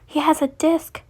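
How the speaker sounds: mild, high-pitched whimper. A narrator's reading. Wounded Chi-Chi warning the others, mild whimper. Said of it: "Chi-Chi whimpered".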